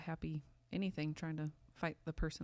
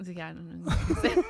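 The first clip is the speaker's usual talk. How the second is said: ordinary talk, nothing marked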